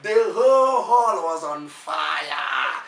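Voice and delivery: loud, slow, drawn-out triumphant shout; real excited storyteller's climax; excited exclamation. Real, not acted. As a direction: belt it out, stretching the words.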